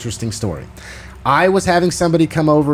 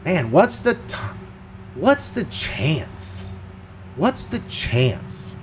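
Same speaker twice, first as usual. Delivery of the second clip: wondering; 2 semitones higher, 6 dB quieter, much slower than usual. Retold or imitated. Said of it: "I just kept thinking".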